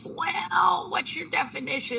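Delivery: high, drawn-out, soft hedge; coy hedging. Retold or imitated. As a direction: dodge the question delicately, voice lifted. Retells hedging reply about being clean.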